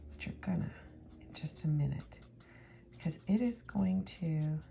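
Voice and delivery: soft, breathy crafting whisper; hushed ASMR-style craft talk. Acted. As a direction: lean in and whisper the steps.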